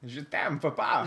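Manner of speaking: hammed-up French accent; mock French accent, delivered as a gag. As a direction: play at a bad French accent. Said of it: "let's try it with a French accent".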